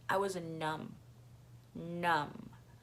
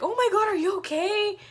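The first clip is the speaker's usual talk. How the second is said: much higher, louder voice of a fretting mom; alarmed mom imitation; 12 semitones higher, 5 dB louder than usual. Imitating a mom running to the fallen rider, alarmed. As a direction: mimic a mom rushing over, high and loud. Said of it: "and she was like".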